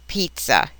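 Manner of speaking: "pizza" with a TS sound; crisp T-S in the middle of "pizza"; double Z as TS. Shown as a demonstration. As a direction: say "pizza" as PEET-tsa. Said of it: "pronounced like a T S".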